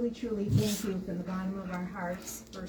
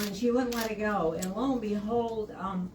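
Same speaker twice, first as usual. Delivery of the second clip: ordinary delivery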